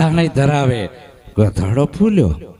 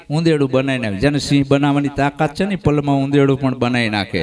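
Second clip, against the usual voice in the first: usual speaking voice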